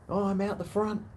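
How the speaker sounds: high-pitched imitation of the buyer. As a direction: pitch up for the other guy. Buyer voiced in story.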